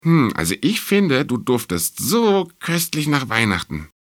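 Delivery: high, eager little mouse; mouse character. A narrator's reading. Mouse admiring how the star smells of Christmas, high-voiced. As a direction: sniff with delight in a high mouse voice.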